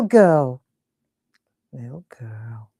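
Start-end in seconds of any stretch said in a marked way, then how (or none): 1.7-2.7 hushed, low murmur to herself; very soft whisper while absorbed in work. Real, not acted. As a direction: whisper it under your breath.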